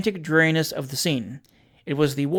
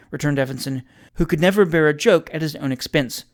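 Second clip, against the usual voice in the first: ordinary delivery, nothing marked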